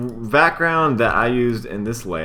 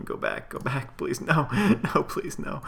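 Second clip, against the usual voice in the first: pleading; 6 semitones higher, 5 dB quieter than usual. Real, not acted. Begging the software window to close.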